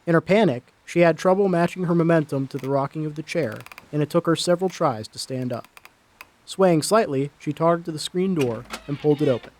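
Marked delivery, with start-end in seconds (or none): none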